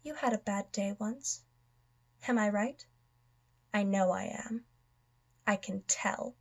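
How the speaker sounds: quiet probing. Acted. Villain telling his foe he had a bad day, quiet probing. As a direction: soften right down and needle the listener.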